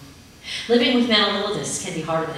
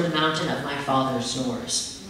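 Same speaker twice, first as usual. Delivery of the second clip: ordinary delivery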